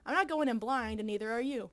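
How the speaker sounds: ordinary talk, nothing marked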